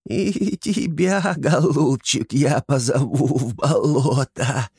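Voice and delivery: high, slow, cooing hag voice; baby-talk it like a creepy swamp witch; lisping kikimora coo. Acted. Антон as kikimora coos at Sher, high lisping croon. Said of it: "просюсюкал Антон".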